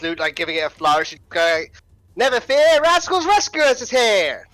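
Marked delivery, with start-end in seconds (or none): 2.2-4.5 loud, high heroic proclamation; heroic battle cry. Acted. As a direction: announce the rescue with bravado. Bard charging in, heroic cry.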